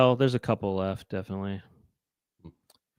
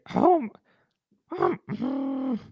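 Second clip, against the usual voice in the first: climbing into a high Marge voice; warming up a Marge voice; attempt at Marge; 11 semitones higher, 3 dB quieter, much slower than usual. Trying Marge for the podcast, rising high. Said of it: "I can't really do Marge".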